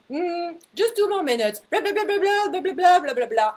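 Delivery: high, loud blah-blah mockery; mock ranting. Mocking her own rant in the car, high loud blather. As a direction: rattle off nonsense like an impatient ranter.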